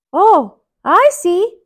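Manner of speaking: high, loud mouse voice; Mickey voice. Acted. Mickey looking up at the giant, high voice. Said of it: "Mickey said".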